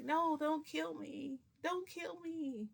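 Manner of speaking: high, slow, drawn-out begging; panicked pleading. Retold or imitated. Storyteller begs the gunman for her life, wailing plea. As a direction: plead for your life, stretching the words.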